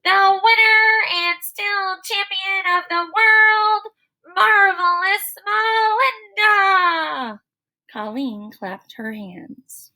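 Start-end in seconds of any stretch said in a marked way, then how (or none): 0.0-7.4 high, loud, slow ring announcer; bellowing announcer. A narrator's reading. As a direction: boom it out like a boxing announcer. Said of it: "she bellowed".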